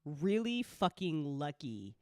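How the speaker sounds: ordinary talk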